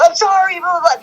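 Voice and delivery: high, squeaky, hurried sorry; mock nervous apology. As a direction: play the flustered, scared apology. Scared apology to angry Billy.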